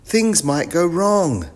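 slow, high, loud warning; yelled warning. A narrator's reading. Biff warns the professor, yelling high and slow. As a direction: call the warning out slowly and high. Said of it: "yelled Biff".

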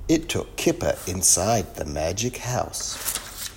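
usual speaking voice

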